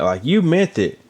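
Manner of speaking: ordinary delivery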